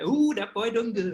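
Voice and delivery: high, soft Southern proud-papa voice; Southern accent. Retold or imitated. Co-host echoing the proud papa in a Southern accent. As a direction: croon it like a doting Southern papa. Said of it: "we've gotta do it in that accent there".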